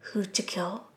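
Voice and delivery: a menacing voice from behind, icy and soft; icy cold voice. A narrator's reading. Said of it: "An icy cold voice sounded behind him".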